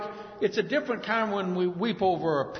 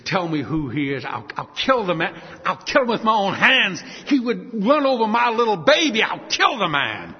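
threat building to a loud peak; acted vengeful fury; 3 semitones higher, 6 dB louder than usual. Grieving father threatens the driver, acted vengeful fury. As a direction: build to a raised, furious vow. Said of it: "the deep emotions the man's feeling".

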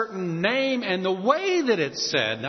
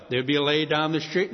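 ordinary talk

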